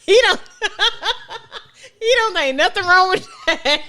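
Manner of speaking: talking through high laughter; host cracking up over a student's swearing, laughing. Real, not acted. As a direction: giggle the words out.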